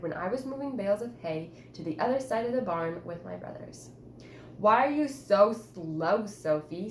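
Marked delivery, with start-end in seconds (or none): 4.6-6.9 slow, loud impatient snap; snapped. A narrator's reading. Brothers snap at slow Sophie, loud and slow. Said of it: "snapped my brothers".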